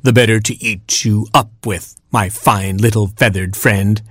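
ordinary delivery

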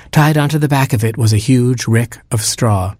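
ordinary delivery

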